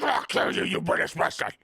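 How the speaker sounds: hammed-up murderous screech like a rabid cartoon; Futurama's Franklin lunging at the British guy, shrieking in rage; frenzied cartoon rage. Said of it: "clawing and slobbering at the mouth".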